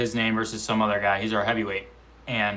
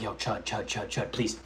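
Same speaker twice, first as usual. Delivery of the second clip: quiet pleading; 3 semitones lower, 12 dB quieter than usual. Retold or imitated. Begging for an easy opponent.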